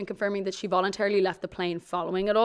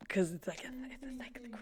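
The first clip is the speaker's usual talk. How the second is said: hushed, careful whisper; whispered caution; 16 dB quieter than usual. Real, not acted. Host whispers the legal caveat to her co-host. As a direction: lean in and whisper it.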